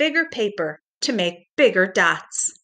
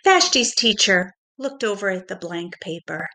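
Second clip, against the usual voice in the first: gentle storybook narration for little ones; reading to children. A narrator's reading. Pre-K teacher reading a story to children, gentle storybook voice. Teacher reads picture book aloud.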